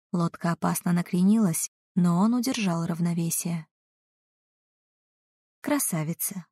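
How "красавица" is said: quietly. Acted. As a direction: say it softly, drawing her in. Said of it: "негромко сказал Ратмир".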